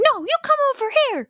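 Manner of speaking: very high, fast mock child voice; hammed-up high-pitched kid retort; arguing little boy. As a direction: squeal it back insistently, fast. Acted sibling argument, child answers back.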